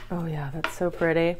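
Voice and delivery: usual speaking voice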